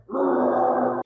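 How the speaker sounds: a loud, breathy roar of a grumpy bear; storybook bear roaring. A narrator's reading. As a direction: push out a loud airy growl. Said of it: "the old bear roared".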